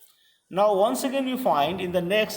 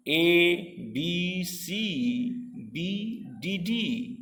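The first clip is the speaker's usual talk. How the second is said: letter by letter; 4 dB quieter, much slower than usual. Shown as a demonstration. Spelling out a rhyme scheme.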